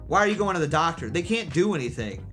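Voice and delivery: ordinary talk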